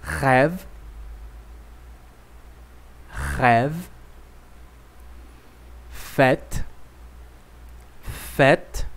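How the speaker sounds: each word twice, with pauses to repeat; patient teacher drill; French repetition drill. Shown as a demonstration. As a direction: model for the class to echo. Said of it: "Repita comigo".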